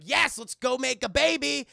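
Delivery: high, loud, slow hammed-up enthusiasm; mock eager Rock. The Rock to Vanessa Kirby, mock eager and loud. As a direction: ham up a giddy Rock. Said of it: "And The Rock's like".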